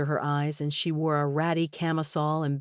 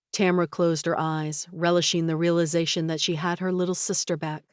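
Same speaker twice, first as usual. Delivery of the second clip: ordinary delivery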